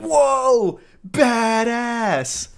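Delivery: hammed-up "Whoa! Badass!"; mock amazement. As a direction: overdo the awe like a dork. Mocking the guy's texts.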